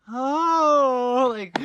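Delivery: the smile drops in sudden alarm; shocked gasp. Retold or imitated. Realizing he scared a stranger, high shocked cry. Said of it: "my smile goes from, like".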